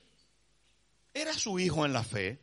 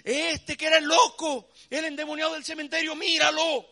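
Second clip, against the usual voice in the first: higher, louder amazed exclaim; astonished crowd; 9 semitones higher, 5 dB louder than usual. Acted. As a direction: gasp at the healed madman. Astonished townspeople quoted. (asombro de la gente del pueblo)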